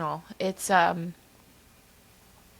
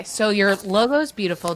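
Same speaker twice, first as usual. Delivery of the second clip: ordinary delivery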